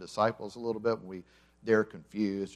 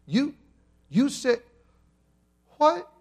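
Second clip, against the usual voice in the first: much higher, rising shock and confusion; comic disbelief, pitch climbing; baffled disciples; 6 dB louder than usual. Retold or imitated. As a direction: stammer it out like stunned disciples. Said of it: "can't you see Peter, James, John, and the rest of them going".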